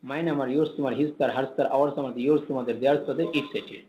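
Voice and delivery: brisk unbroken recitation; quickly, in one go. Shown as a demonstration. Teacher showing how to recite the pronouns, quickly in one go. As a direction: run the words together at speed. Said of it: "একশেষে বলতে হবে তাড়াতাড়ি করে".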